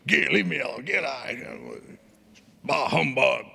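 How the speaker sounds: hammed-up Scrooge grumbling; mock grumpiness. Preacher pretending to brush off wife and children, mock grouchy. Acts out treating his family grumpily.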